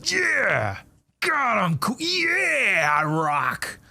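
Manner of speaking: high, drawn-out gloating yeah; hammed-up cheering for yourself; mock self-congratulation. Host pretending to watch videos of himself, mock self-congratulation.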